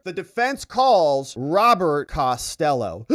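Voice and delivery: slow, drawn-out big reveal; dramatic announcement. Retold or imitated. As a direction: announce it like a bombshell.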